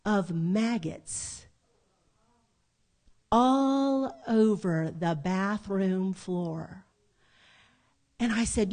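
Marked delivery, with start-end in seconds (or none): 0.1-6.9 very slow, drawn-out retold reveal; dramatic reveal. Sister revealing maggots under the floor, slow dramatic reveal. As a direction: stretch every word out for horror.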